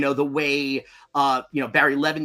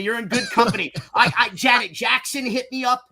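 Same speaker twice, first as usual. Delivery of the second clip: normal talking voice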